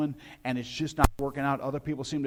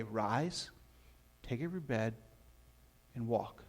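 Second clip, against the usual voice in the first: softly spoken command; 4 semitones lower, 6 dB quieter, slower than usual. Retold or imitated. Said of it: "he hears Jesus say".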